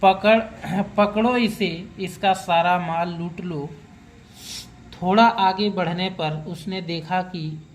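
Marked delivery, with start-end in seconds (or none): none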